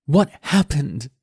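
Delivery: put-on high, slow, bitter teen girl throwing it back; mock his question in disgust; furious daughter echo.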